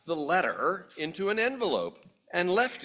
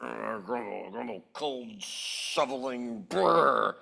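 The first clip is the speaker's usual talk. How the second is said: low, soft shivery grumble; grumbling neighbor; 6 semitones lower, 5 dB quieter than usual. Acted. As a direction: grumble as if freezing. Grumbling about the cold.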